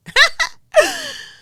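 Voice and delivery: loud high-pitched burst of laughter; high squealing laugh. Real, not acted. Laughing at the mic being too close. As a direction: shriek with laughter.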